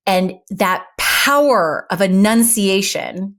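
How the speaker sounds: each word of "power of enunciation" spelled out slowly; crisp, deliberate enunciation, slow; slow, enunciated delivery. Shown as a demonstration. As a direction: lean on "power" and space the words out. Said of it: "just as you see me deliver it right now".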